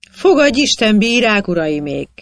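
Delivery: loud, high return greeting, easing down; hearty greeting. A narrator's reading. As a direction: hail the village worthies right back. Pap visszaköszön a részeg elöljáróknak. (hangos, magasan induló köszöntés)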